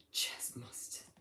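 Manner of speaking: whispered name. Real, not acted. Streamer reading a chat username to himself, whispered. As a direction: breathe the name under your breath.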